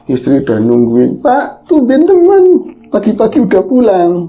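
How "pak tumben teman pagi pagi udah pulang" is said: wife's surprised greeting. Retold or imitated. Wife greets husband home early.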